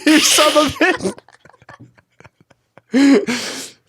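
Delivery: high, drawn-out mock curse; fake-scandalized yell of son of a bitch; playful mock insult; mock outrage. Mock outburst at friend.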